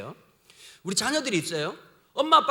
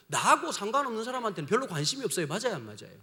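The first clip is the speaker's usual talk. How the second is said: ordinary talk, nothing marked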